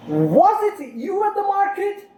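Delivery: "Was it you at the market" climbing very high; rising question intonation. Shown as a demonstration. Teacher demonstrates a yes or no question, exaggerated rise. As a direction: sweep up from WAS and stay high through MARKET. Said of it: "questions where you can use rising intonation".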